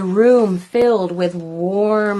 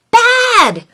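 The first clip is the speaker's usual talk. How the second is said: high squeaky cry; squeaked; 13 semitones higher, 4 dB louder than usual. Acted. Little Doom squeaks at the monster, high. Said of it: "Little Doom squeaked".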